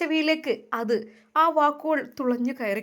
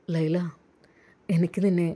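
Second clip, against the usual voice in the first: put-on lower, gentle young man's voice; lower, tender groom talking to his bride-to-be; the groom's voice; 8 semitones lower than usual. A narrator's reading. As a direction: speak as the suitor who wins her over. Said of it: "പുരുഷൻ്റെ ശബ്ദം അത് അയാളിൽ നിന്ന് വന്നു".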